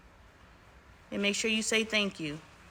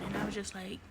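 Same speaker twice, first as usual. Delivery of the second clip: normal talking voice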